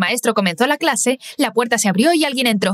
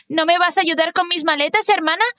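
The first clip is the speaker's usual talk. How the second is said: llamada aguda y juguetona, sin mucho volumen; llamada a gritos; 6 semitones higher than usual. A narrator's reading. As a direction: llamar a la hermana desde lejos, en broma. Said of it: "la escuché gritar". (Susie llama a su hermana en la estación, en tono de broma)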